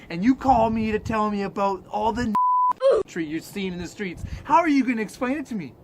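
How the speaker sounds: ordinary delivery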